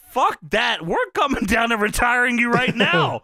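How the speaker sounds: angry Shane. Retold or imitated. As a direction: snap back with a threat. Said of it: "Shane says".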